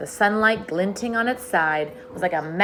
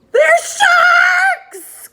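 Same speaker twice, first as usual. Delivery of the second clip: screaming shriek, much higher; terrified cry; 12 dB louder than usual. A narrator's reading. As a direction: shriek it in terror. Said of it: "cried the earthworm".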